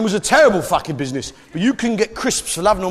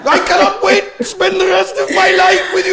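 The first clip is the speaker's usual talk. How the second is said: hammed-up pissed-off Welshman; 14 semitones higher, 5 dB louder than usual. Comedian plays a Welsh groom on his wedding day, comically tormented. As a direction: wail it as if dying of inner hurt. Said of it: "he sounds pissed off, doesn't he?".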